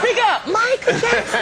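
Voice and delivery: high slow loud call; mock shout. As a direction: call out to him. Telling Michael to speak louder.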